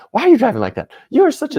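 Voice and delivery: high, loud indignant outburst; mock road rage. Man snapping at another driver in traffic, loud mock outrage. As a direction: yell at a bad driver, voice shooting up.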